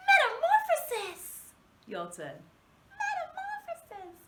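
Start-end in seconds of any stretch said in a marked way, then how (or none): 0.1-1.5 much higher, louder dainty princess. Shown as a demonstration. Teacher saying the word like a princess for kids. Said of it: "Let's say it like a princess". 3.0-4.2 a dainty little princess squeak. Shown as a demonstration. Teacher repeating the word like a princess. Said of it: "Let's say it like a princess".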